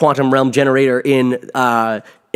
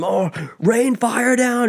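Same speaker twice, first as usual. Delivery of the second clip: high, drawn-out mock battle cry; desperate villain order; 10 semitones higher, much slower than usual. Thanos orders fire on his own troops, desperate mock cry.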